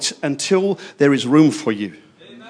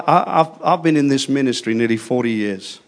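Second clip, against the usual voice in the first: ordinary delivery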